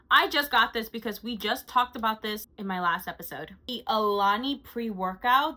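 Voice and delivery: usual speaking voice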